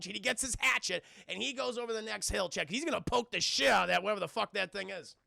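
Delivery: ordinary delivery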